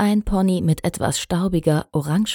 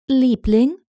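higher, questioning call to a sweetheart; 7 semitones higher, 4 dB louder than usual. A narrator's reading. Princess calls to her husband at drill, high questioning call. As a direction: call over with a rising, lilting question. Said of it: "rief sie Shining Armor zu".

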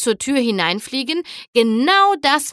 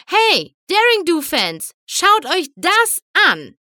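high, excited call to a crowd; high-pitched rallying call; 7 semitones higher, 3 dB louder than usual. A narrator's reading. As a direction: show off to the fans, slowing at the end. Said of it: "rief Rainbow".

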